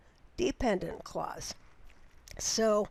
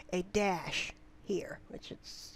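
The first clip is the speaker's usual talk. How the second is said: whispered aside; 3 semitones lower, 7 dB quieter, slower than usual. Real, not acted.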